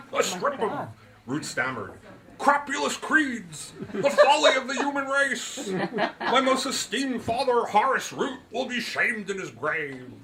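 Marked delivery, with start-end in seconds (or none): 0.1-0.9 put-on higher, faltering old gentleman; stammered. A narrator's reading. Root stammers in surprise, higher and faltering. 2.4-10.1 much higher, louder, indignant; outraged Root. A narrator's reading. Root protests the insult to his league, high and indignant.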